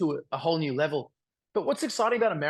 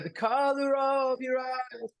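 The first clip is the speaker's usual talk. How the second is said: singing-style Australian eyes, slow and higher, almost like ass; Australian accent; 7 semitones higher, much slower than usual. Shown as a demonstration. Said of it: "it's my Australian accent".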